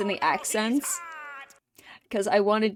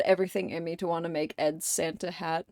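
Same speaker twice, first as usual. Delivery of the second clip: normal talking voice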